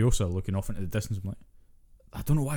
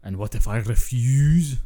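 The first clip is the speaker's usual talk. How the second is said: slow, louder, hammed-up fake Scottish accent; Chris Hemsworth's bad Scottish accent; 2 semitones higher, 7 dB louder, slower than usual. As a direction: drag it out in a clumsy put-on Scottish accent. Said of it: "one of the worst in recent memory was Chris Hemsworth".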